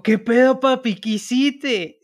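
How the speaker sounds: high, loud Tabasco greeting; acento tabasqueño. Acted. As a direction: call out to a buddy in a boisterous Tabasco accent. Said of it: "ahí se me sale". (saludo fuerte con acento tabasqueño)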